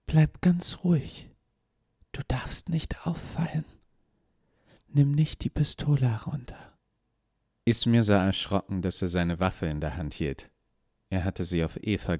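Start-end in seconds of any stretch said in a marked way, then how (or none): 0.1-6.8 high, urgent whisper of a woman. Acted. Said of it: "Sie flüsterte".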